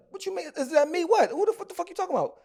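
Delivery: stunned retold protest, high and outraged; incredulous protest. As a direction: throw the question back in disbelief.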